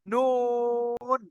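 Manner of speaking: নুন held very slowly; নুন sustained slow for the class to repeat; model sound. Shown as a demonstration. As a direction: draw out নুন with a long hum. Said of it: "বলেন, সবাই বলেন".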